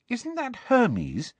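amazed. A narrator's reading. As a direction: let the wonder stretch the words out. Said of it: "sounding amazed".

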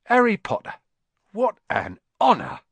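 high, loud, delighted exclamation; awestruck old barman. A narrator's reading. Barman meeting Harry Potter, awestruck. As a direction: exclaim it high, overcome by the honour.

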